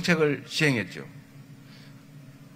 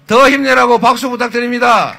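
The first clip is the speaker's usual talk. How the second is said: louder, higher shout for applause; rally shout; 4 semitones higher, 9 dB louder than usual. Real, not acted. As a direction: rouse the crowd to clap. Rally crowd, asking for applause.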